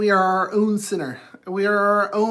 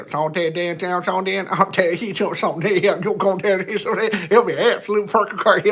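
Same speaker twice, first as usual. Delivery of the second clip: Southern accent. Shown as a demonstration. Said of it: "Southern accent bag".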